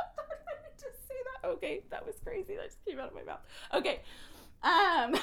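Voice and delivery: real embarrassed laughter; laughing. Real, not acted. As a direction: laugh at what you just said. Laughs at her outburst.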